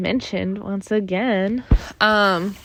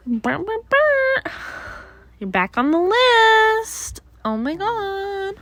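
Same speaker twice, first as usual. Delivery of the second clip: high, slow put-on voice; chirpy high cartoon voice; silly high voice; 11 semitones higher, slower than usual. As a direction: play a squeaky mock voice.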